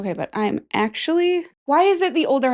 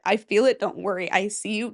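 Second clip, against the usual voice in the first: normal talking voice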